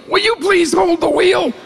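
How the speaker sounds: terrified passenger pleading; pleading in panic. Retold or imitated. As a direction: beg him to grab the wheel. Jake begging him to steer.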